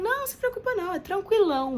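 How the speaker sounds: high, easygoing reassurance; breezy anesthetist. Retold or imitated. Anesthetist calms the nervous patient, mock breezy. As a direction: brush off her nerves lightly. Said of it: "Ele falou".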